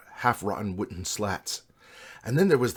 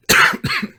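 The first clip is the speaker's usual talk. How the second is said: loud real throat-clearing cough; 12 dB louder than usual. Real, not acted. Reader interrupts the story, coughing. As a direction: cough hard mid-reading.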